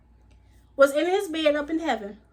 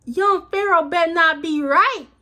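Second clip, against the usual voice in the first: higher and louder, pleading that it isn't so; worried outburst; 5 semitones higher, 8 dB louder than usual. Retold or imitated. Vlogger recalls dreading his vision, louder higher outburst. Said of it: "I was like".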